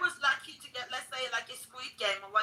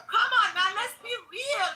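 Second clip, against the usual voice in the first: higher, louder plea to be real; pleading; 8 semitones higher, 4 dB louder than usual. Real, not acted. Debating an anti-immigration guest, pleading loudly. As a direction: urge him to drop the act.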